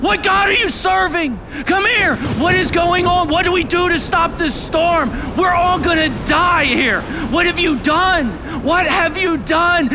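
very high, loud interrogation; panicked sailors yelling at the culprit; frantic shouting. Acted. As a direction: demand answers over a raging storm. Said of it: "they're shouting".

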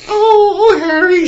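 high breathless swoon; swooning girl. Retold or imitated. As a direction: gasp it out like a smitten girl. Acting out Hermione swooning.

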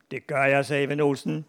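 put-on lively read-aloud for children; story narration. A narrator's reading. Author reads a schoolboy tall tale to an audience, animated storytelling. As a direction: tell a comic tale with animated character quotes.

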